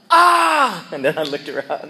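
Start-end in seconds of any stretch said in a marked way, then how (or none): 0.1-0.9 loud, high cry of pain; yelled ah. Retold or imitated. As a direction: yell out as the leg goes. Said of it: "I literally yelled out".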